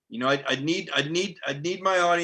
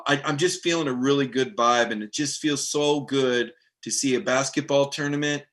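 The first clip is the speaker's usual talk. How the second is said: normal talking voice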